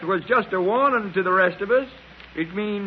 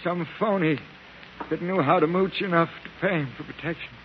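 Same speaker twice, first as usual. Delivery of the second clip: usual speaking voice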